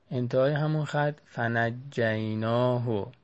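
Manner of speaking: ordinary talk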